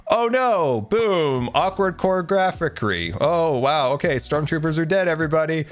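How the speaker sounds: high, hammed-up fake panic; exaggerated high-pitched mock drama; mock alarm. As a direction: play a badly acted action scene. Acting out the scene mockingly.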